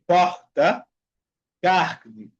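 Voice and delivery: "Porta, carne" with each R as a Spanish jota. Shown as a demonstration. Teacher showing Rio pronunciation, syllable-final R as jota. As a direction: say "pojta", "cajne" for "Porta", "carne". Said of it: "como un J en español".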